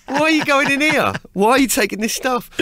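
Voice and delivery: high, loud, exasperated questions; incredulous cop. Retold or imitated. Cop challenging a comedian at security, loudly incredulous. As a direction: demand answers in mock disbelief.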